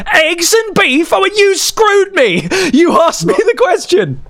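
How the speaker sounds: cracking up at the answer; amused teacher, incredulous and high; teacher laughing. Retold or imitated. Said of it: "bursts out laughing and goes".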